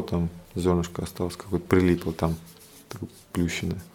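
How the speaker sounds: ordinary talk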